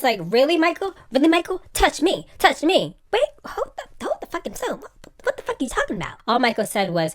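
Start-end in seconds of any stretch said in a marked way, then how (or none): 0.2-3.0 mock challenge. Acting out a confrontation. 3.1-6.2 mock bafflement.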